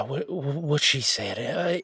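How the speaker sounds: normal talking voice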